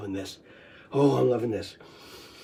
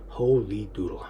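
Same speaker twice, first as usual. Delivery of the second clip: usual speaking voice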